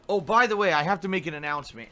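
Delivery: normal talking voice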